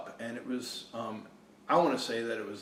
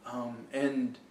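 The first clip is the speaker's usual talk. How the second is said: ordinary delivery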